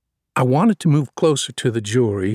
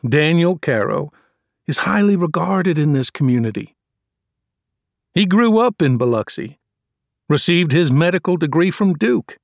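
higher, raised voice praising his client to the jury; courtroom oratory; 4 semitones higher than usual. Acted. Defense lawyer humanizing his client to the jury, fervent oratory. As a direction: sell the jury on the man's good name. I was warming to the speech.

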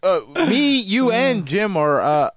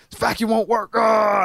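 higher-pitched mock frustration; retold angry outburst; 5 semitones higher than usual. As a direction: act out losing patience with an appliance. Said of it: "I was just mad".

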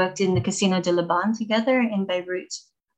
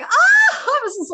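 loud high laughing yelp, then laughing through the words; laughing shout; 10 dB louder, slower than usual. Retold or imitated.

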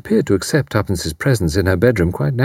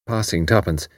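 ordinary delivery

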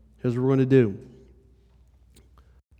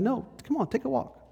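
much higher, softer coaxing voice; 13 semitones higher, 5 dB quieter than usual. Acted. Preacher as Jesus invites a rushing believer along, coaxing. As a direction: gently beckon in a high, light voice.

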